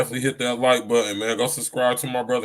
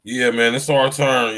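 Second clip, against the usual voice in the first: louder "It's our turn" punched on "our"; rallying shout; loud catchphrase; 9 dB louder than usual. Retold or imitated. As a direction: call it out like a rallying cry. Catchphrase called out.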